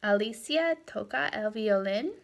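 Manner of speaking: "Alicia toca el violín" with a steep rise on "violín"; question intonation. Shown as a demonstration. Teacher quizzing students by intonation, "violín" rising sharply as a question. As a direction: make the statement a question by lifting "violín". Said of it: "That's a question".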